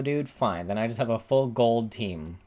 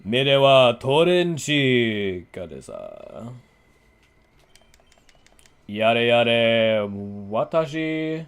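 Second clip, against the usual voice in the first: slow, loud, booming villain proclamation; roaring grunting brute growl, hammed up; anime villain voice; 5 dB louder, much slower than usual. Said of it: "You gotta sound like an anime villain".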